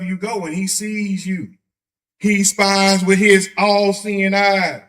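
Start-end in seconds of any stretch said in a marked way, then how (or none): 2.2-4.8 loud, slow preacher build; slow each word and boom it out; slow booming sermon line. Acted.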